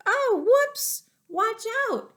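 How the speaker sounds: high, loud, startled cry; excited exclamation. A narrator's reading. Storyteller reacting to T-Rex tripping, high and excited. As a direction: gasp out the warning.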